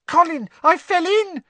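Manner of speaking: put-on very high, loud excited child; shrill. A narrator's reading. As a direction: squeal the news to your brother. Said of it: "he said shrilly".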